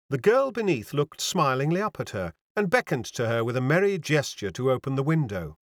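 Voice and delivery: ordinary delivery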